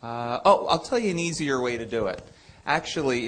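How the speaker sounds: ordinary talk, nothing marked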